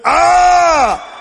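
loud, high "Ah!"; startled cry. Acted. Acting out a startled reply in story.

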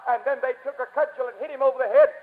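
ordinary talk

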